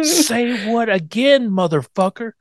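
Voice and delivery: slow, high mock threat, voiced as Eddie; mock tough guy. Friend imitating Eddie for laughs, slow mock threat. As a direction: drag it out like a movie tough guy. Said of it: "mock Eddie threat".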